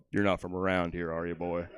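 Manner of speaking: low, slow southern sheriff voice; lightly voiced suspicious southern lawman; southern drawl. Comic's inner voice to a stranger, low southern drawl. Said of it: "I thought to myself".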